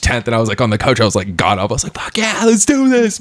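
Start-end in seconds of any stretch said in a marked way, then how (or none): 1.9-3.2 high-pitched excited self-quote; pumped up cheer. Retold or imitated. Fan on the couch cheering Cadillac's first points, pumped. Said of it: "I got super pumped".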